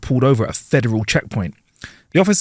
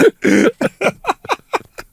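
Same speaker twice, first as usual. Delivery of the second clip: laughing. Real, not acted. Laughs at punchline.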